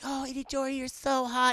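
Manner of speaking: put-on high-pitched swooning girl voice; mock anime admirer; anime waifu. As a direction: coo in a hammed-up high voice. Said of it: "Anime Waifu is like".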